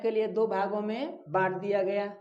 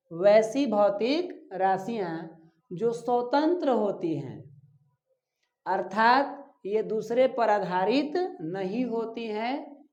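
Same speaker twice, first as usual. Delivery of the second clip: slow dictation; slower than usual. Shown as a demonstration. Teacher dictating definition.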